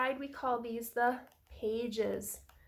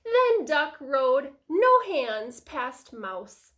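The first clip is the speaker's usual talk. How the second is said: usual speaking voice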